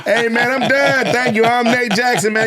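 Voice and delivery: high, projected farewell to a crowd; mock stage sign-off. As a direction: call out goodnight as if wrapping a show. Re-enacts his hurried onstage sign-off.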